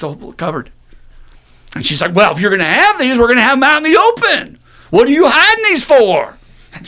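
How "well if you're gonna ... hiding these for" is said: much higher, louder indignant challenge; mom confronting. Retold or imitated. Mom confronting stepfather about hidden magazines, loud, high and indignant. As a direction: throw it in his face, loud and high.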